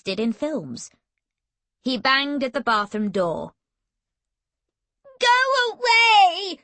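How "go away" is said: high, loud tearful shout; tearful booming. A narrator's reading. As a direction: boom it out through tears. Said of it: "boomed Emily from inside".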